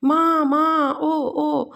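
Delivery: mother, mother, a moaning cry; pained cry for mother; loud groan of pain. A narrator's reading. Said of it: "Manoj ज़ोर से कराता है".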